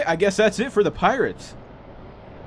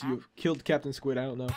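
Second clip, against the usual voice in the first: ordinary talk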